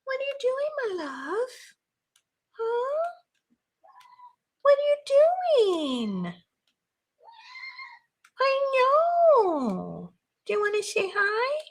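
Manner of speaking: squeaky high pet voice to the cat. Real, not acted. Crafter cooing to her cat in a high pet voice.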